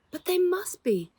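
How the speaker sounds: put-on a girl protesting her missing brothers must be home; upset protest. A narrator's reading. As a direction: insist they must be there. Rose protests children missing.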